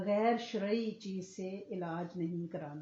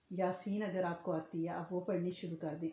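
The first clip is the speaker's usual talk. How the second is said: ordinary delivery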